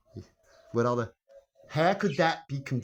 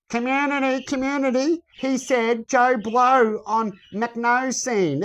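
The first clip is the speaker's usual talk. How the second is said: higher, slow mocking voice; mocking imitation; 8 semitones higher, 4 dB louder, slower than usual. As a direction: imitate them with a sneer, slowly. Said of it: "that they said".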